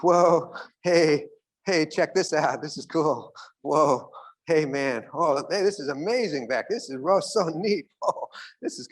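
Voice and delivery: high, excited and easily distracted, then flustered; the scatterbrained friend chasing every shiny thing. Distracted friend acting excited about everything around him, then flustered.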